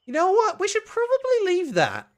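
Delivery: high, loud nervous urging; alarmed. Acted. Character urging others away from the painting, alarmed.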